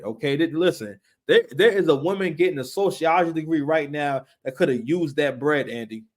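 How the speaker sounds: ordinary talk, nothing marked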